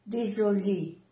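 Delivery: "désolé" with a voiced z; soft Z. Shown as a demonstration. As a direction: say "désolé" with a buzzing z. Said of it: "como si fuera una Z suave".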